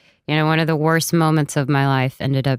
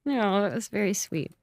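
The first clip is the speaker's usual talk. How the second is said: proud tears; 6 semitones higher, 7 dB quieter than usual. Real, not acted. Recovering after tears.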